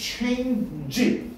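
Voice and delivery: exchange를 높게, '체인'을 길게; exchange를 '익스-체인-지'로 나눠서. Shown as a demonstration. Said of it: "어떻게 발음해야 돼". (exchange의 ch를 '취'로 분명히)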